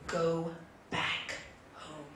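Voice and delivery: low, grave pronouncement, fading away; storyteller's fish, deep and final; solemn magic fish. Acted. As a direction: deliver the final verdict low and slow. Magic fish gives final verdict.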